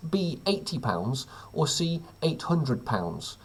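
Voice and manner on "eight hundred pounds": usual speaking voice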